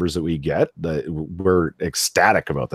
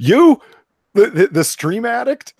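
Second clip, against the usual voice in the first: high, loud teasing disbelief; mock disbelief; 8 dB louder, slower than usual. Real, not acted. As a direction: play up the shock.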